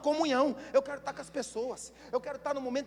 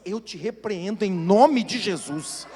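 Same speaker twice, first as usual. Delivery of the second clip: slow, much louder declaration of rebuke; 4 semitones lower, 9 dB louder, much slower than usual. Shown as a demonstration. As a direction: rebuke Satan with full force. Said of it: "vai falar assim".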